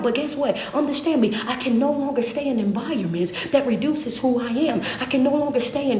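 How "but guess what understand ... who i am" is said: ordinary talk, nothing marked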